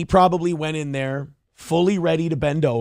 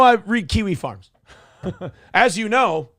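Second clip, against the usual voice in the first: ordinary talk, nothing marked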